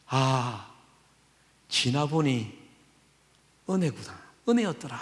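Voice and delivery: soft, low, reflective; a hushed sigh of realization; quiet grateful confession. Retold or imitated. Believer looking back on hardship, softly grateful. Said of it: "이런 고백 하시죠".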